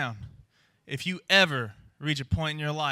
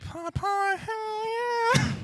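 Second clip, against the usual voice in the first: much higher, giddy cheer; stoned excitement; much slower than usual. Retold or imitated. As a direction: squeal it like a thrilled stoner. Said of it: "So I'm just like".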